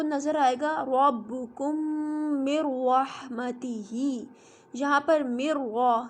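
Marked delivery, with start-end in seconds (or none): 2.4-4.3 रहमतिही very slow with a doubled, thick r; heavy doubled r. Shown as a demonstration. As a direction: stretch मिर रहमतिही and lean on the doubled r. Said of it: "रहमतिही की रॉ पे शद है". (मिर रहमतिही धीरे, शद वाली मोटी रॉ के साथ)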